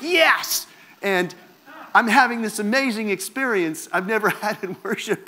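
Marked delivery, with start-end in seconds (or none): 0.0-0.7 high, loud cry of triumph; joyful yes. Retold or imitated. Caught up in worship, a loud delighted shout. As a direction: throw it up like a cheer. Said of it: "And I'm like".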